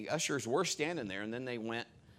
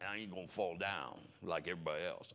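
mocking big guy; 4 semitones lower than usual. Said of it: "he's like".

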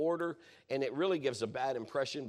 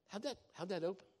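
curious asker; 6 semitones higher, 8 dB quieter than usual. Retold or imitated. People asking about the lock.

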